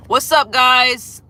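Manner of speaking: cheery greeting. Real, not acted. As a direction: greet the new viewers brightly. Greeting viewers who join.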